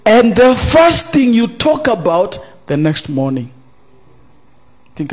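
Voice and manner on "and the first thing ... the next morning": loud, high preached line; loud preacher's call; preaching burst. Acted. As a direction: raise it to the congregation.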